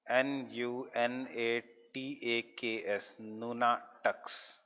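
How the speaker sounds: slow letter-by-letter spelling, then the word; measured dictation of a new term; spelling out slowly. Real, not acted. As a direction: spell it out for note-takers.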